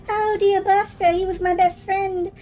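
put-on high, wistful kid voice; sad child. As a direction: remember a lost friend. Child recalls friend Buster.

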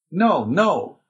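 high, alarmed refusal; crying out. A narrator's reading. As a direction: cry out in protest, pitch jumping up. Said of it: "cried the Abbé".

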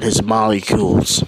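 ordinary delivery

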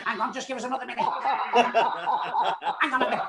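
high words squeezed through laughter; mock helpless giggling. Actor imitating co-star begging for another minute, giggling. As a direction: imitate someone corpsing mid-line.

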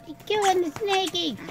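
ordinary talk

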